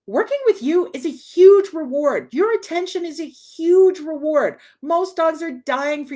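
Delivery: loud, high enthusiastic emphasis; emphatic. Real, not acted. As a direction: sell the reward with real energy. Trainer stresses the real reward.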